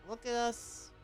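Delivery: ordinary talk, nothing marked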